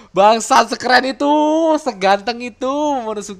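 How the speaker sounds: high, loud fan gushing; excited outburst. Real, not acted. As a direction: shout it in amazed admiration. Fan bursting out over the character. (seruan kagum, tinggi dan heboh)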